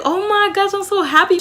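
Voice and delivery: high, gushing friend voice; happy for friend. Acted. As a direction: gush with delight for your friend. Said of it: "So happy for me".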